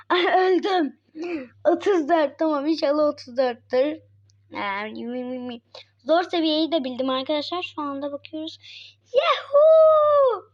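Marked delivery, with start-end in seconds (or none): 0.0-1.0 slow, laughing cry of ay öldüm. Real, not acted. Laughing after the count. 9.1-10.4 high, loud victory whoop; excited cheer. Real, not acted. Celebrating the hard level win.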